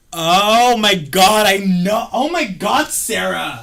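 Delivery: loud, high, drawn-out exclamation; excited recognition. Real, not acted. Recognizing old coworker Sarah, loudly excited.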